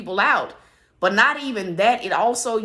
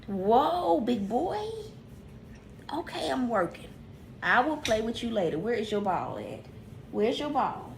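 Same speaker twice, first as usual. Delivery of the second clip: much softer, gentle words to a big dog; soft talk to dog; 8 dB quieter than usual. Real, not acted. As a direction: hush the dog while working. Soothing dog while working.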